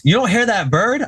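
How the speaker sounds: high-pitched mock disbelief; retold incredulous question. Sleepless friend to roommate about chirping, incredulous.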